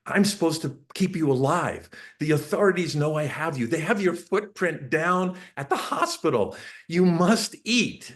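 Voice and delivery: high-pitched mock panic, building; rising urgency, slowing hard on the last words; retold panic. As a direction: plead desperately with a baby. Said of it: "I panicked".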